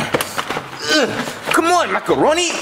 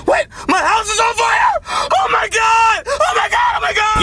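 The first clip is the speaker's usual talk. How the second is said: panicked shout; 9 semitones higher than usual. Acted. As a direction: scream in rising horror at the fire. Discovers the house on fire.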